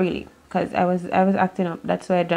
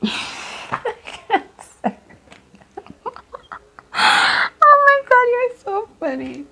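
high squealing laugh through the words; 4 dB louder, much slower than usual. Real, not acted. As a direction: laugh out loud, much higher. Cracks up at the card.

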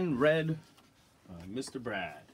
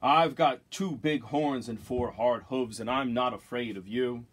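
big loud voice; 6 dB louder than usual. Acted. Third goat standing up to the troll, big loud voice. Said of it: "as big and loud as the troll's".

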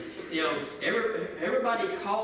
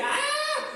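high laughing yelp; a quick playful scream; mock holy yelp with a laugh. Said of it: "I screamed and".

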